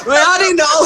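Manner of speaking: laughing. Real, not acted. Laughing while retelling surprise.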